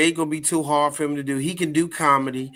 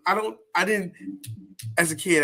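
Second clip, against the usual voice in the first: normal talking voice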